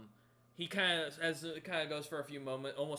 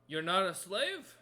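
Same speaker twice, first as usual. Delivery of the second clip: loud, slow, higher-pitched disbelief; surprised crewman; 6 semitones higher, 8 dB louder, slower than usual. Acted. Crewman questioning the stranger he took for a slave, surprised. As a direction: ask it slowly, taken aback. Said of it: "looks at you and goes".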